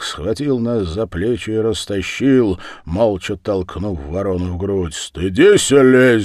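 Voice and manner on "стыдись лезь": loud, raised-pitch rebuke; booming angry order; thundering. A narrator's reading. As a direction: thunder at them in outrage. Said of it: "загремел он".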